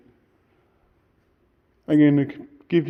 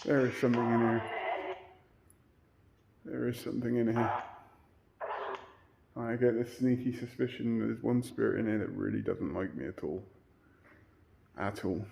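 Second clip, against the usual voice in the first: much softer, lower, near whisper; quiet fearful murmur; hushed and uneasy; 3 semitones lower, 11 dB quieter than usual. Real, not acted. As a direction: drop the voice, spooked. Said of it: "I cannot tell you what I'm feeling".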